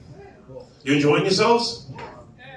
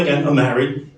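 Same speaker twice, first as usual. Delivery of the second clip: usual speaking voice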